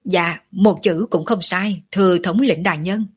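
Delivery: ordinary delivery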